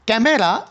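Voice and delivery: ক্যামেরা in three syllables, "ke-me-ra"; full pronunciation. Shown as a demonstration. Teacher gives students the unshortened word, ক্যামেরা as "ke-me-ra". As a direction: sound the middle e in ক্যামেরা. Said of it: "ইর উচ্চারণটা বাদ".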